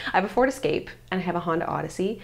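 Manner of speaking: normal talking voice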